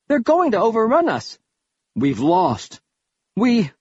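a touch of rising panic, breaking off; alarmed despair. A narrator's reading. Soldier to comrades as the enemy digs in, alarmed.

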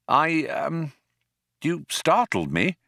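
mildly flustered, faltering; lame, awkward. A narrator's reading. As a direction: offer a feeble, awkward explanation. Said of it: "he said, lamely".

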